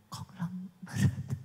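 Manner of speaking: whispered, slow Konglomerat. Shown as a demonstration. Preacher mocking a timid whispered confession. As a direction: breathe Konglomerat unsure. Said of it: "Jangan mulutnya ngomong".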